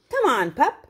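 ordinary delivery, nothing marked

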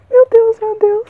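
high, excited exclamation; real fangirl excitement; overjoyed disbelief. Real, not acted. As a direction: cry out in thrilled amazement. Overjoyed at receiving a longed-for book.

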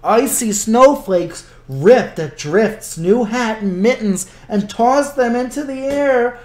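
lively storybook narration; reading to children. A narrator's reading. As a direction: read it like a children's book. Librarian reads picture book.

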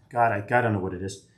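ordinary delivery

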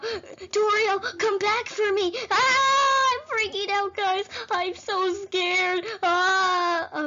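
high, wailing panic; mock freak-out. Player left alone calls for Toriel, mock panic. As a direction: cry out in hammed-up terror.

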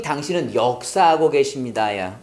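ordinary talk, nothing marked

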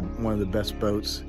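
normal talking voice

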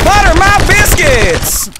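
slow, loud southern holler; southern exclamation. Pretend. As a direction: drawl it out big and loud. Character catchphrase exclamation.

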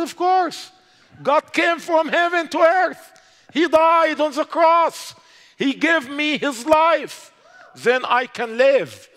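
high, loud, slow proclamation of faith; bold preaching. Retold or imitated. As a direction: preach it triumphantly to the official. Bold confession before interrogator.

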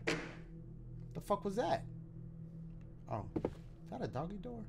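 slow, hushed and unvoiced; scared whisper. Real, not acted. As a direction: breathe it out, afraid of the noise. Hears a noise in horror game.

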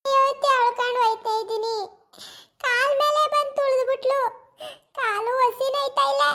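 high, wailing complaint, slowing at the end; pained wailing. Acted. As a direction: moan about the trampled leg. Beaten woman wails about her leg.

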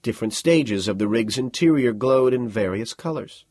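ordinary delivery, nothing marked

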